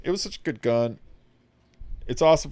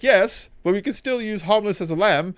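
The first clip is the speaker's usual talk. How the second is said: higher, helpful little gun voice; talking gun Skippy; 8 semitones higher, 4 dB louder than usual. Retold or imitated. Talking gun Skippy offering the harmless mode, higher and helpful. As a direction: offer the other mode brightly. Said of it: "He's like".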